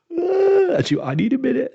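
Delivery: hammed-up weepy relief; imitating happy tears. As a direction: wail it like tears of joy. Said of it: "And she was crying".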